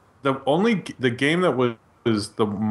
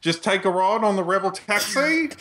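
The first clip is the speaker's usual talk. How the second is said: Australian accent; 8 semitones higher than usual. Shown as a demonstration. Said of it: "I could do the rest of the podcast like this".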